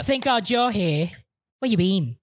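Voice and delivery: put-on teacher scolding late class in an Australian accent; Australian substitute teacher. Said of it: "you're a sub on Abbott Elementary".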